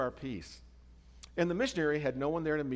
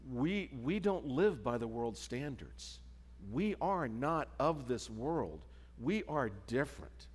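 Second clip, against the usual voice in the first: solemn emphasis; 2 semitones lower, 5 dB quieter, slower than usual. Acted. Sermon's key point, slowed.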